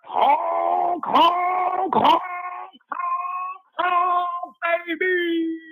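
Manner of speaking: high goose honks, shouted; goose honking. Pretend. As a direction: honk like a silly goose. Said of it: "Let the goose loose".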